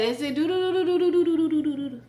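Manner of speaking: doo-doo-doo suspense riff, rising; playful ominous doo-doo sound; mock dramatic suspense hum. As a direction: hum a spooky suspense tune. Humming mock suspense tune.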